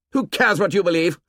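put-on high-pitched, sneering boy; cross, contemptuous challenge; angry. A narrator's reading. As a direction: hurl it as a taunt. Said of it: "unaccountably angry".

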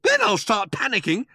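high, hurried indignant rant; snappy. A narrator's reading. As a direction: insist testily, pitched right up. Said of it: "snapped Arthur".

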